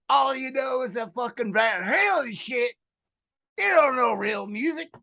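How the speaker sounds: heated hillbilly lecture. Acted. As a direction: tell off the city folk. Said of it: "do the podcast in that voice".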